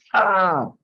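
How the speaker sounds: sharp high mock yelp of pain; retold cry of pain. Ramana crying out when pinched, short mock yelp. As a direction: imitate a sudden cry at a pinch. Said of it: "simplemente me dolió y grité".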